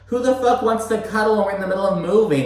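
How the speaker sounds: high, fast, incredulous brush-off; exasperated snap. Retold or imitated. Stanberry brushing off husband's cuddle mid-move, fast exasperated. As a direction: fire it off, fed up with him. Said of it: "And she's like".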